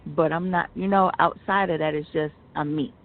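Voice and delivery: ordinary talk, nothing marked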